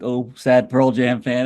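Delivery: lightly mocking, drawn-out pity; soft mocking. Songwriter character quietly judging a crying burger customer, mildly mocking. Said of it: "sort of softly mocking".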